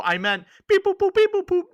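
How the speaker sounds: high, loud chirpy droid bleeps; droid beeping. Retold or imitated. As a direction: chirp the beeps like a little robot. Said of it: "makes the droid noises".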